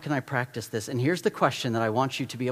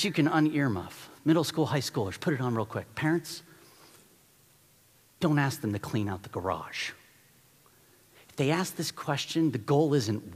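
usual speaking voice

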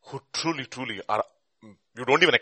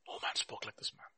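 near-whispered repeat, very soft; barely voiced, hushed repetition; hushed echo; 14 dB quieter than usual. Real, not acted. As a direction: let it sink in under your breath. Repeats the quote in awe.

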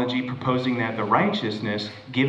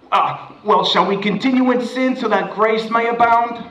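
mocking objector; 11 semitones higher, 5 dB louder than usual. Voicing worldly objector mockingly.

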